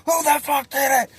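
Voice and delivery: high-pitched mock-furious holler; imitated mad yelling. Cellmate finds something under his bunk, high angry yelling. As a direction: rage like a cellmate demanding the culprit. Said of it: "he's getting so mad".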